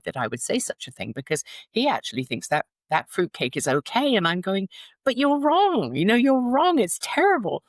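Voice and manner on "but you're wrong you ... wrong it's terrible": high, loud retold protest; exasperated, drawn-out insistence, pitched way up; insistent arguing. Wife contradicting her husband about fruitcake, insistent arguing.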